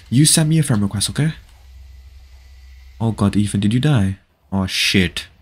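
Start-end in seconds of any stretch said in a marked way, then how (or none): none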